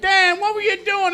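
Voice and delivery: very high, put-on friend voice; mock concerned friend. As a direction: ask like a worried buddy. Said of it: "They're like".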